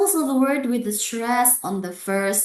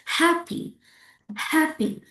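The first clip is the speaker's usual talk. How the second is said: HAPPY with a high, stressed HAP; first-syllable stress; 8 semitones higher than usual. Shown as a demonstration. Teacher repeats the model word, stress on HAP. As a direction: lean on HAP in HAPPY, light -py. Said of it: "Put the stress on the first syllables".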